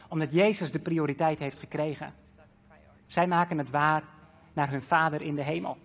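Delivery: normal talking voice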